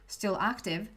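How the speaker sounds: normal talking voice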